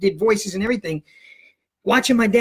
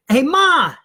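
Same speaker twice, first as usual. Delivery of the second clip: high, loud yell for Mom; calling out; 8 semitones higher, 6 dB louder than usual. Retold or imitated. Teen pretends to call his mother for the teacher, loud and high. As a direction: holler to someone in another room.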